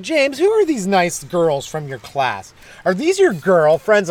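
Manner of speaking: slow, sing-song mock mom; teasing parent impression, stretched words; embarrassing parent. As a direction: gush at your kid, drawn out. Imitating his embarrassing parents.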